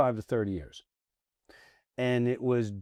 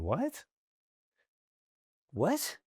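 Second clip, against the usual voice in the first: stunned disbelief; 7 semitones higher, 4 dB quieter than usual. Retold or imitated. Stunned reply to insult.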